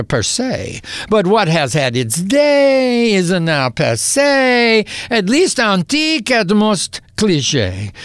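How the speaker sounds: rhythmic, performed poetry reading; poem recital. Acted. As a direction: recite it like a stage poet. Reads his French poem aloud.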